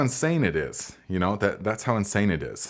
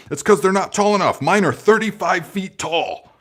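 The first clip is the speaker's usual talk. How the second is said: higher, louder mock know-it-all commenter; 8 semitones higher, 5 dB louder than usual. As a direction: parrot the smug commenter, pitched up and loud. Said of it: "people have commented on my video".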